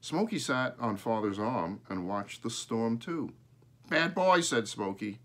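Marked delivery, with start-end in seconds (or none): 3.9-4.4 high, loud squawky words; crow talking. A narrator's reading. Crow scolds the storm, high squawky. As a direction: squawk it like a parrot.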